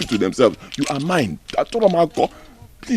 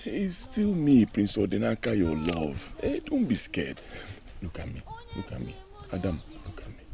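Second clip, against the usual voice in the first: soft soothing; 4 semitones lower, 9 dB quieter than usual. Acted. Calming a scared, unresponsive lover.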